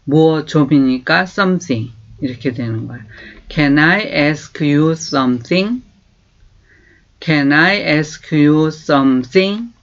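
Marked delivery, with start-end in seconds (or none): 3.5-9.7 very slow, clear English for learners to copy; slow model phrase. Shown as a demonstration. Teacher slowly modelling a question. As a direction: stretch each word for repeating. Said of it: "다시 한번".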